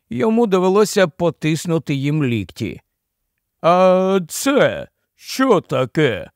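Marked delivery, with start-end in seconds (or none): 3.6-6.3 slow, high, drawn-out query; puzzled royal curiosity; curious question. A narrator's reading. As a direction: ask as an intrigued king, stretching the words. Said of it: "поцікавився король".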